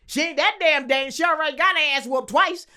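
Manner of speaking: loud, high-pitched scoffing; trash-talk like a fired-up mother; brash, loud motherly dismissal; imitated indignant mom.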